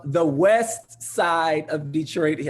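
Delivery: "west side" drawn out slowly and loudly; emphasis on "west side". Real, not acted. Host shouting out a viewer's Detroit neighborhood, emphatic "west side". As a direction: lean hard and long on "west side". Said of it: "he wanted me to emphasize that".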